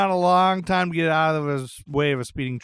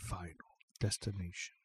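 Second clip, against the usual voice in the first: low, hushed echo of the phrase; foreboding murmur; ominous undertone; 10 semitones lower, 16 dB quieter than usual. Acted. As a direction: drop right down, very soft and low. Echoes the line in ominous undertone.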